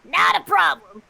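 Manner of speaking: commanding shout. Acted. General commanding.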